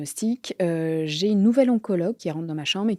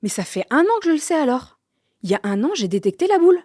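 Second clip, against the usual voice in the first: stunned disbelief; 8 semitones higher, 5 dB louder than usual. Retold or imitated. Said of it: "je fais".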